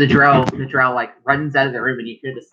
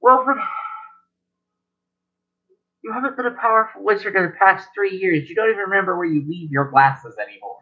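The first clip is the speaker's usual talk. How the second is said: scolding grandmother; 5 semitones higher than usual. Acted. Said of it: "Your grandmother looks at him and goes".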